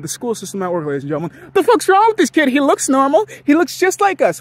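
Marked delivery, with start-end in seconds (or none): 1.5-4.4 loud, high-pitched indignant mimicry; pissed-off kids. Classmates resenting the kid with heart issues, pissed off. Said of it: "other kids would clearly get pissed".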